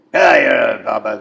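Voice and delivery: bait the crowd like a ham wrestler; mock crowd-baiting yell.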